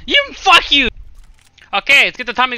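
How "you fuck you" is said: loud, high-pitched cursing; heated gamer yell; angry outburst. Real, not acted. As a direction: curse out the player who got you. Cursing at opponent.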